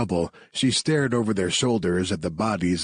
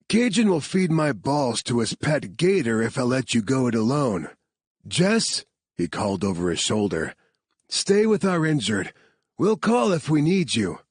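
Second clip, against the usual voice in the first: lightly voiced gruff soldier, higher than narration; 6 semitones higher than usual. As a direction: joke darkly, then bark orders. Marcus refuses to let her go.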